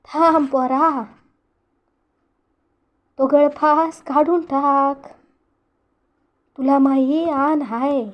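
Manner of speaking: put-on slow, trembling old man's plea; old father begging his son to remove the noose; pleading old father. A narrator's reading. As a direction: beg him to stop, slowly and heavily. Old father begs son to stop.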